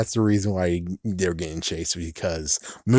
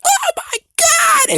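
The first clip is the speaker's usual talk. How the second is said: piercing high drawn-out shriek; mock-horrified wife's stretched scream; Missus McCall; 6 dB louder, slower than usual. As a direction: scream it in hammed-up horror. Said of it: "here is Missus McCar".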